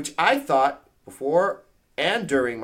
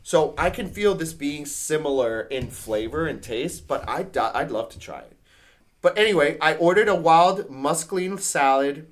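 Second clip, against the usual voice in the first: normal talking voice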